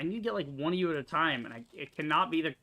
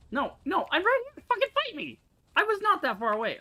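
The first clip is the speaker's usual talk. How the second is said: much higher, loud, angry shout; furious yelling; 6 dB louder than usual. Real, not acted. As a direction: yell at the enemy to fight. Yelling at fleeing enemy.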